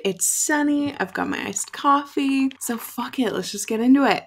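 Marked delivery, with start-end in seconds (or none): none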